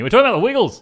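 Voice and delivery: loud, high announcer-like burst; excited reveal. Pretend. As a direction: shout the big reveal with gusto. Big topic reveal after mock-solemn build-up.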